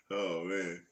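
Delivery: normal talking voice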